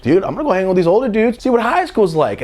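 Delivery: high, fast and eager; thrilled teen bragging quickly; hyped boast. Retold or imitated. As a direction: sell it like a kid bursting to go. Excited boast to friends.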